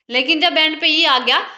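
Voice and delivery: ordinary delivery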